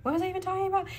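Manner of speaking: ordinary talk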